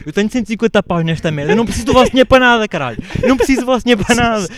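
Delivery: grito furioso e agudo, rápido; a berrar de raiva no restaurante; gritar irritado. Retold or imitated. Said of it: "basei do restaurante a gritar". (rapaz perdido grita com os clientes, furioso e agudo)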